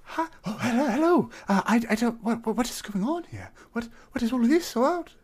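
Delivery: high, stammering nervous greeting; timid satyr voice. Acted. To the tavern crowd, a high, stammering shy hello. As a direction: squeak out a shy hello at the bar.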